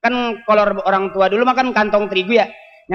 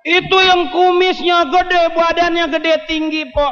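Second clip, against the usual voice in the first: acted neighbour; 9 semitones higher, slower than usual. High acted voice.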